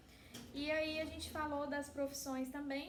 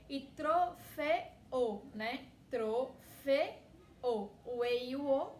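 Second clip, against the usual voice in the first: slow syllable demo; 6 dB louder, much slower than usual. Shown as a demonstration. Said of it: "que são duas fortes, que se separam".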